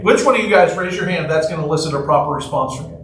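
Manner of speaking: ordinary delivery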